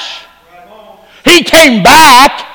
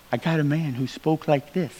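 imitating the mobster's barely audible murmur, much softer and lower; near-silent murmur; 7 semitones lower, 18 dB quieter than usual. Preacher imitates the cautious mobster, very soft murmur. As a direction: drop almost to nothing, as if dodging a hidden microphone. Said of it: "he spoke like that 'cause he didn't want to ever be recorded".

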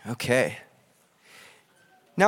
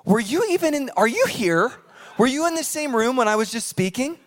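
exasperated; 6 semitones higher than usual. Retold or imitated. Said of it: "we're like".

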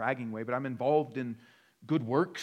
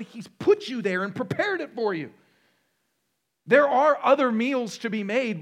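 building preacher intensity; 8 semitones higher, 8 dB louder than usual. Acted. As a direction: swell into a loud preaching climax.

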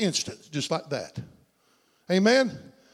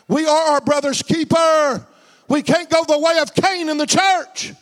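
higher, emphatic pulpit exhortation; full-force preaching; 6 semitones higher, 4 dB louder than usual. Real, not acted. Preacher exhorting the congregation to care for brothers, emphatic preaching. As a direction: preach it out to the whole church.

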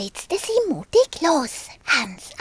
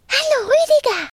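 put-on higher, louder, fast little girl calling out; 11 semitones higher, 5 dB louder, faster than usual. The girl Marie calls to her dragon friend, high and loud. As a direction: call out brightly as a small girl. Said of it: "rief sie".

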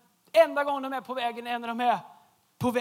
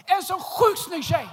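loud slow punchline; 6 semitones higher, 5 dB louder, slower than usual. Real, not acted. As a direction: hit it loud and slow, drawn out. Repeats punchline after aside.

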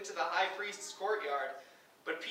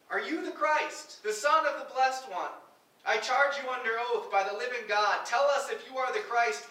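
raised, insistent interrogation; demanding high priest; 6 semitones higher, 6 dB louder than usual. Acted. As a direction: charge him like an accusing judge. High priest demands under oath.

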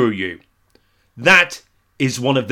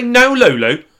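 higher, louder snappy reply; indignant retort; 8 semitones higher, 6 dB louder than usual. Pretend. [name] snaps back at Lulu as an argument erupts. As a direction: bristle and snap back at her. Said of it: "And I'd say".

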